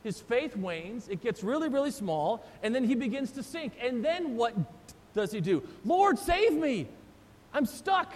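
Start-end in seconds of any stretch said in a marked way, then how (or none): 5.8-8.1 high, slow desperate plea; sinking Peter crying for Jesus to save him, mock panic; panicked cry. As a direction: wail for help as you go under.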